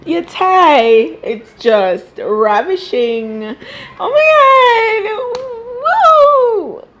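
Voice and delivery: hammed-up giddy fan gushing; mock gushing caller.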